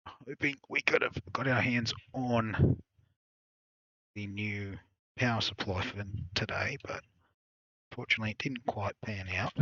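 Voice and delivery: ordinary talk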